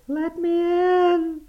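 very high, thin wailing plea; wailing ghost child. Acted. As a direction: wail the plea through the glass. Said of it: "Still it wailed".

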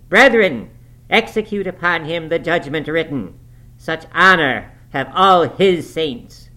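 a zealous preacher condemning the sinner before the congregation, loud and lofty; fiery preacher. Acted. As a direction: preach damnation from the pulpit. Narrator voices preacher Branderham.

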